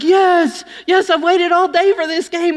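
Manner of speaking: high and loud, bursting with relief; eager yes. Retold or imitated. To the youth pastor, high loud eager yes. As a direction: gush that you've waited all day.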